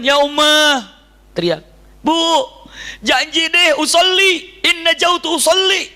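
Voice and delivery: high, loud desperate cry; pleading shout. Retold or imitated. Boy cries out to a woman, begging to be saved. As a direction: call out to the woman for rescue.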